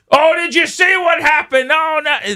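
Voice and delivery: high-pitched hammed-up alarm; mock big production. Co-host mimicking his partner's bumped-elbow fuss, mock-alarmed shout. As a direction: imitate a co-host making a huge fuss. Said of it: "It's a big production".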